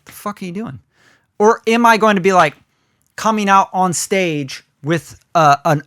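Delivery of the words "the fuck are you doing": a crowd member muttering at a dull opener, softly baffled; muttered audience reaction. Retold or imitated. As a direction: mumble it under your breath like a confused crowd. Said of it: "And they're like".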